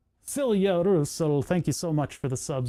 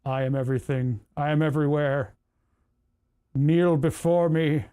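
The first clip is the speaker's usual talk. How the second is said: booming arrogant alien overlord. Pretend. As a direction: command them to kneel, grandly. Posing as 3D head scan.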